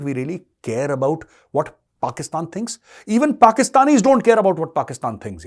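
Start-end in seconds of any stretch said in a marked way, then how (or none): 3.1-5.5 louder and higher, scornful; raised scornful voice. Real, not acted. Commentator mocking Pakistan to its audience, raised scornful voice. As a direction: throw the line up in open scorn.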